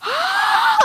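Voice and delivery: high-pitched shocked inhale; big squeaky gasp of delight; excited gasp. Retold or imitated. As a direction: gasp sharply, re-enacting fangirl shock. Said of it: "and I was like".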